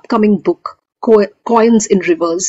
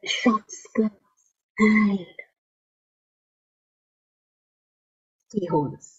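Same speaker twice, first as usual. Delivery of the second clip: near-whisper, much softer, with long pauses; hushed poem ending; 11 dB quieter, slower than usual. Acted. Poet closes Keyholes for festival audience, hushed ending. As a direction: let the last words fade into a whisper. Poem's closing words, hushed.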